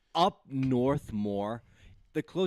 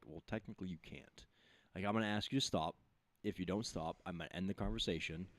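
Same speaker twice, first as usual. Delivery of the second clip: much softer, lower professional voice; calm de-escalation; 7 semitones lower, 10 dB quieter than usual. Retold or imitated. Worker handling someone filming them, calm low script. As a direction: state the steps quietly, evenly.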